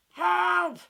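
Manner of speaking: high, loud strained cry; rusted tin man crying for help; grunted cry for help. Acted. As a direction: push the word out stiffly. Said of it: "he grunted".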